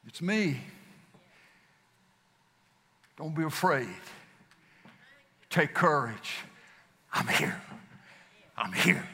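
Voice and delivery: soft, gentle voice of comfort; tender reassurance. Acted. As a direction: reassure them quietly, like calming a scared child. Jesus speaking to frightened disciples.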